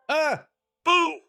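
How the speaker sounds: sudden high yelp of fright; startled scream. Real, not acted. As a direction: jump at the scare and yelp. Startled by a jump scare.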